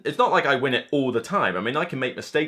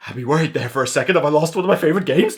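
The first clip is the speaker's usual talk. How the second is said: mock panic; 6 dB louder, faster than usual. Mock panic over lost game.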